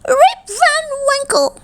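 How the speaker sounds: very high, eerie far-off cry; strange calling voice. A narrator's reading. Mysterious stranger calls to Rip on the mountain, high strange call. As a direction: call out the name in a high, unearthly voice. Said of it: "again the voice called his name".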